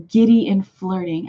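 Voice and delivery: ordinary talk, nothing marked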